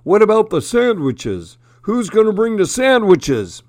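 high-pitched, demanding moose voice; moose speaking up. A narrator's reading. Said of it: "Morris the Moose spoke up".

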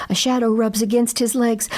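plain reading voice

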